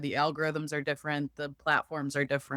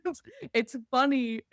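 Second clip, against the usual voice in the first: laughing; 9 semitones higher than usual. Real, not acted. Laughing after the joke.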